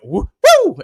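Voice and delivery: high-pitched "woo" cheer; excited whoop. Acted. Host cheering a chat message's exclamation point, excited whoop.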